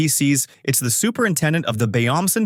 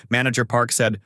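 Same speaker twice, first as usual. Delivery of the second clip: plain reading voice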